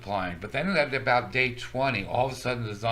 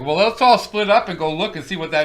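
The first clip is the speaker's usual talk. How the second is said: mock-eager voice, raised and loud; clueless horror-movie character; 6 semitones higher, 9 dB louder than usual. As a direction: imitate a foolish victim rallying the group. Mimicking foolish horror-movie characters.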